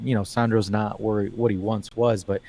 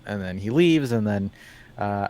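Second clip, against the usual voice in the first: ordinary talk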